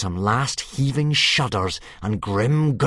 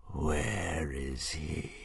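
very slow, much softer dying-dragon voice; weak dragon hiss; 5 semitones lower, 12 dB quieter, much slower than usual. Acted. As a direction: rasp it out with the last of your strength. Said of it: "he hissed weakly".

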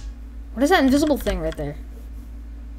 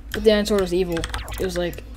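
ordinary talk

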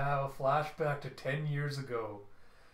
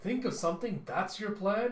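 incredulous; 6 semitones higher, 4 dB louder than usual. Retold or imitated. Daughter doubting father's plan.

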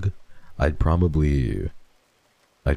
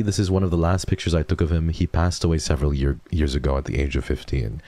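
usual speaking voice

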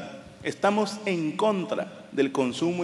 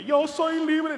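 much higher, airy mocking voice; mock free spirit; 11 semitones higher, much slower than usual. Mocking women over thirty who claim to be free, high mock voice. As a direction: parody a carefree woman over thirty. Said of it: "De que".

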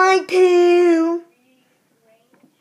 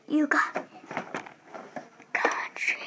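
whispering; 10 dB quieter than usual. Real, not acted. Whispered aside.

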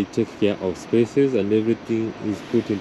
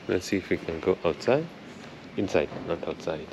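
softer, hushed voice indoors; 3 semitones lower, 10 dB quieter than usual. Real, not acted. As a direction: keep it down so no one notices you filming. Filming quietly inside concert house.

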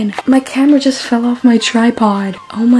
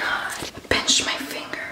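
whisper; 11 dB quieter, slower than usual. Real, not acted. Whispering after hurting finger.

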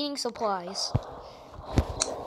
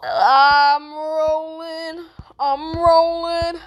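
drawn-out call; 3 semitones higher, 4 dB louder, much slower than usual. Pretend. Rolling in the game.

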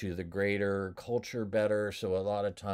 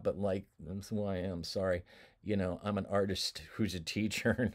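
usual speaking voice

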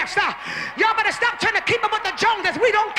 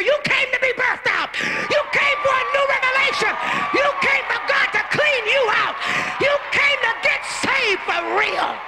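full-force preacher shout; shouted preaching; 5 semitones higher than usual. Acted. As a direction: hammer each line at the congregation. Preacher shouting the point home.

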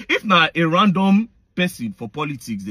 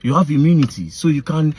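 ordinary talk, nothing marked

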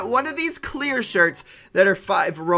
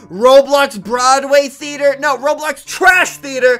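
mocking roast; 5 semitones higher, 8 dB louder, slower than usual. Roasting a Roblox shirt.

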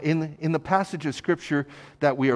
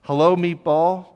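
higher, louder hammed-up hello meatball; mock greeting; 6 semitones higher, 8 dB louder than usual. Imagined shopper greeting the meatball lady, hammed-up loud hello. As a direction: sing out the silly greeting.

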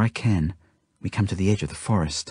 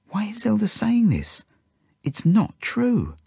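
high-pitched, incredulous protest; dismayed disbelief; 13 semitones higher, slower than usual. A narrator's reading. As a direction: question it in helpless dismay. Narrator dismayed by friend's claim.

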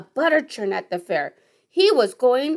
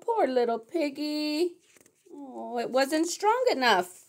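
soft pity; 2 semitones higher, 7 dB quieter than usual. Pretend. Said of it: "reader's own soft pity".

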